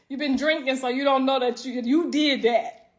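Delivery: ordinary delivery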